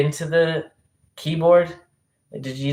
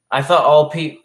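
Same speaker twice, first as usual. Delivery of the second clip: ordinary talk, nothing marked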